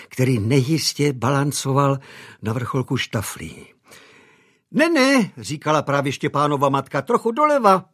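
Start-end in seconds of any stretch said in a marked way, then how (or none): 4.7-5.3 high, fluttering mother directing the butler; a mother fussing over the tree; fussing lady of the house. A narrator's reading. Mother directing butler on ladder, high and fussy. 7.0-7.8 high, fluttering mother directing the butler; a mother fussing over the tree; fussing lady of the house. A narrator's reading. Mother directing butler on ladder, high and fussy.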